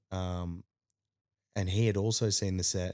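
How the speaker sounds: ordinary delivery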